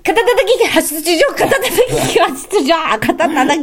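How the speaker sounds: rapid tongue twister. Shown as a demonstration. Partner takes another turn, rapid tongue twister. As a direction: hurry through until the tongue trips. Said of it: "行くよ。".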